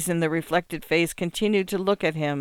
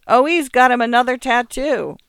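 higher, louder call across the room; yelled; 7 semitones higher, 6 dB louder than usual. A narrator's reading. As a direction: announce it to the whole room. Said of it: "yelled to a few of the machines".

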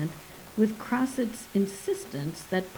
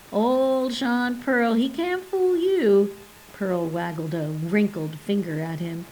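mock praise; 4 semitones higher, 3 dB louder than usual. Pearl mocks the geologist.

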